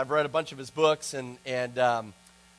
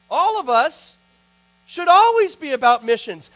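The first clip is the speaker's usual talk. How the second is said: higher, louder pulpit push; impassioned sermon delivery; fervent preaching; 7 semitones higher, 5 dB louder than usual. Acted. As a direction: drive it home to the congregation. Preaches on missions.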